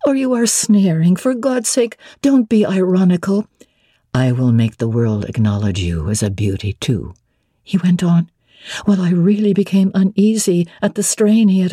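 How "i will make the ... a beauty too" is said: lower, steady male voice for Mr Rochester. Acted. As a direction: drop into the master's lower register, assured. Rochester voiced by female narrator.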